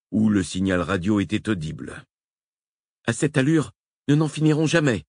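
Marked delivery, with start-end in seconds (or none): 3.1-5.0 Nicole grommelle, agacée, voix plus aiguë; grommellement. A narrator's reading. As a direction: ronchonner d'impatience. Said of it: "grommela Nicole". (Nicole grommelle contre la lenteur des recherches, agacée)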